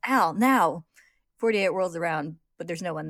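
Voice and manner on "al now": loud urgent call; yelling. A narrator's reading. Ed yells the cue to Al, urgent shout. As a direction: yell the signal to your ally. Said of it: "and then yells".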